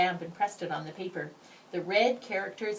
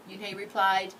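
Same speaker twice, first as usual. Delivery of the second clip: ordinary delivery, nothing marked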